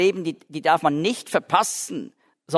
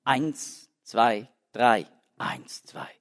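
Eins, zwei, drei counted aloud, then eins, zwei dropped to a whisper; counting the meter; 4 semitones lower, much slower than usual. Shown as a demonstration. Lecturer demonstrating the lament meter, three beats voiced and two whispered. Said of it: "das Typische für Klagelied ist drei plus zwei".

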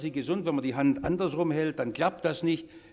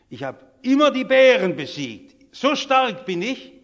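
put-on boastful young fighter, building higher and louder; proud boast; 3 semitones higher, 7 dB louder than usual. Young David boasting to King Saul of beasts beaten, proudly. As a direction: brag with mounting force, drawing out the climax. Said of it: "sagt der David ganz stolz".

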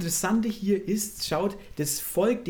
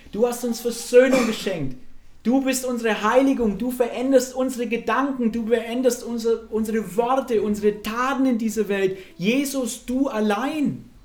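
impassioned, raised-pitch praise to Jesus; leidenschaftlicher Lobpreis; 5 semitones higher, 5 dB louder than usual. Real, not acted. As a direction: proclaim it loudly and fervently, drawing out the last words. (inbrünstig und laut verkündend)

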